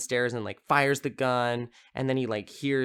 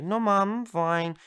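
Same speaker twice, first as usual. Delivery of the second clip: put-on high-pitched kid answering his mother; imitated little boy; 9 semitones higher than usual. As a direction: put on a small child's voice. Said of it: "And he's like".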